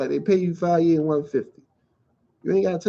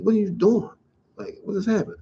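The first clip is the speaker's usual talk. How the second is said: usual speaking voice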